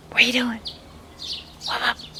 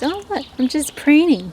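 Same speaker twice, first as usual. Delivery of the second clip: high, loud innocent duck voice; voicing the duck; 7 semitones higher, 6 dB louder than usual. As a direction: play the drake feigning innocence. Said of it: "he's now pretending he's".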